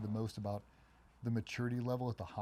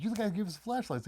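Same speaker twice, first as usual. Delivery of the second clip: much higher, delighted recognition; light up as you place him; glad recognition; 11 semitones higher, 3 dB louder, faster than usual. Retold or imitated.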